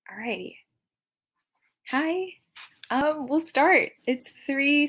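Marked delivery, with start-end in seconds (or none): 0.1-0.6 quick whispered aside to herself; whispering. Real, not acted. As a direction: whisper it under your breath. Aside while setting up stream.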